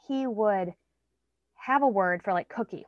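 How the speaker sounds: ordinary talk, nothing marked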